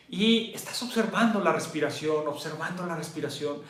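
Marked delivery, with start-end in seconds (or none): none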